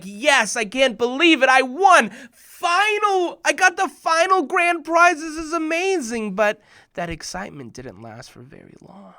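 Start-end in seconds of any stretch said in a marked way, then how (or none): 0.0-6.4 higher and louder, elated cheering; overjoyed. Retold or imitated. Boy celebrating his prize before the crowd, elated. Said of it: "I'm like".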